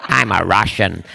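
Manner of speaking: low Scottish voice claiming to be foreign; comic low Scottish impression; Sean Connery. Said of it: "when he was playing a Russian".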